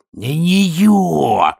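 high, loud, very slow growl; a loud, drawn-out snarl. Acted. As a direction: snarl the correction at him. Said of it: "прорычала Бетти".

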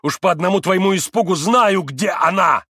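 high, loud accusing cry; angry accusation. A narrator's reading. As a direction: throw it at her in a rage. Mitya accuses.

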